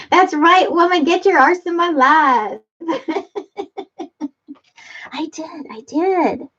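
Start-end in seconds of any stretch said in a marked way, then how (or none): 0.1-2.6 reading the comment in a high, cheeky put-on voice; sassy friend telling you off, higher and brighter; playful character voice.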